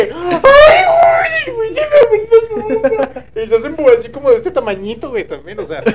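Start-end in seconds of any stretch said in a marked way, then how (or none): 0.0-3.4 put-on very high, shrieking, crying-out girl; scared girl voice. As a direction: wail it like a terrified girl. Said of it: "la morra de que".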